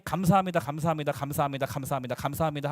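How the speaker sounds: low, even recitation of thanks; repeated thanks. Acted.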